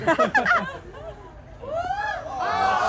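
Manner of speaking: squealing burst of laughter; high shrieking laugh. Real, not acted.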